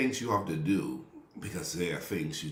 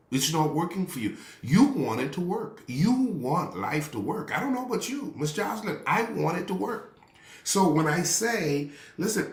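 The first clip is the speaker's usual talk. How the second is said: preaching at full force; 7 semitones higher than usual. Acted. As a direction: drive it home like a pulpit climax.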